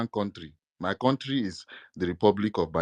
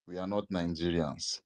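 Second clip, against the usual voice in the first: ordinary talk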